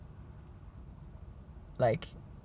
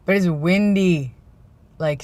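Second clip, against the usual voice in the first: ordinary delivery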